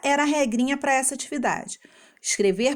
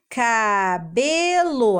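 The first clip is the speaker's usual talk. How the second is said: "Cabelo" very slowly as ca... be... lo; three drawn-out beats in "Cabelo"; syllable by syllable; much slower than usual. Shown as a demonstration. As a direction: piece "Cabelo" together syllable by syllable. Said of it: "vamos juntar e formar a palavra".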